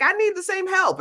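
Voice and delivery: high, eager pleading voice; high pleading mother. Retold or imitated. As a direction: beg for help in a high voice. Mother asking for help.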